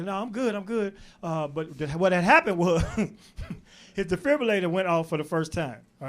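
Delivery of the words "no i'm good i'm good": quiet, weak reassurance; softly brushing off worry; shy. Retold or imitated.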